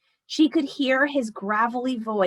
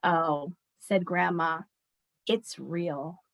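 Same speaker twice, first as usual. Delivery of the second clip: soft; 5 semitones lower, 5 dB quieter, slower than usual. Acted. Grandma answers softly.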